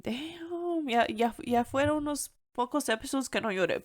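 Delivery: usual speaking voice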